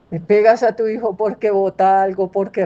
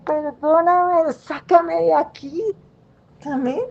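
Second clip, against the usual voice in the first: slow, higher, earnest plea to God; pleading prayer; 5 semitones higher, slower than usual. Retold or imitated. A believer praying to God for forgiveness, slow earnest plea. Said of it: "se lo pongo a Dios ... y digo".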